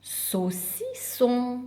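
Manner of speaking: "saucisson" split slowly into sau, ci, sson; slow, measured "saucisson" showing the middle syllable. Shown as a demonstration. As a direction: separate each beat of "saucisson". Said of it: "si je le veux au milieu du mot".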